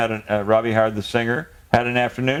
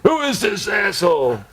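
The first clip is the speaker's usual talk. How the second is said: retold yell; 8 semitones higher than usual. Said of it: "he starts yelling at Jonathan".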